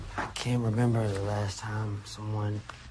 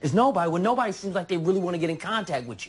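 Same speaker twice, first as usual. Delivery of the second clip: loud, high echo of a partner's excuse; heated sarcastic challenge; angry retort; 6 semitones higher, 6 dB louder than usual. Acted. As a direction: throw the excuse back at them, loudly. Throws her answer back.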